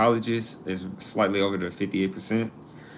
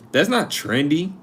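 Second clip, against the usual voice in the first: higher-pitched, disbelieving objection; incredulous; 6 semitones higher than usual. Real, not acted. To chat over a slang phrase, incredulous. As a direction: push back in disbelief, pitched up.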